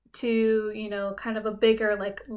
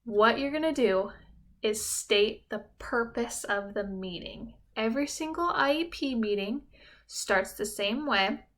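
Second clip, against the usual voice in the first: usual speaking voice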